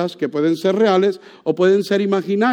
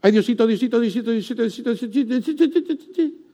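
repeated whimpering plea to God, softer; whiny begging; 4 dB quieter, faster than usual. Pretend. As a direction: nag God like a child.

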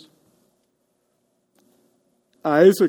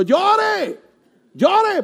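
much higher, loud cry of llore; urging shout; 5 dB louder than usual. Retold or imitated. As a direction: call out llore like permission. Pastor urging weeper to cry.

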